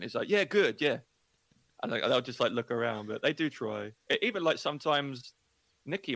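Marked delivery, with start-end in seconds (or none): 0.3-1.0 high, over-agreeable mock reply; louder, pitched-up polite brush-off; polite feigned understanding. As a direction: imitate someone brightly bluffing that they understood. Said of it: "everyone's so polite here".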